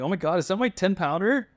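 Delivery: high, excited disbelief; freaking out. Retold or imitated. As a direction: gush over the giant fish. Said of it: "I'm freaking out".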